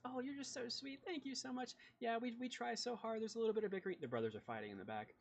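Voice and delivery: put-on high, sweet gushing girl voice; chirpy young woman, all smiles; bubbly sister. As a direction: gush over them like an eager little sister.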